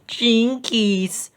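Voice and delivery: high, alarmed Velma; Velma exclaiming. A narrator's reading. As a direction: gasp it out in alarm. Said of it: "Velma exclaimed".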